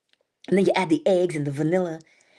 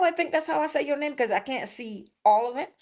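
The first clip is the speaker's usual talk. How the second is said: usual speaking voice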